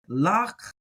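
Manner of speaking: ordinary talk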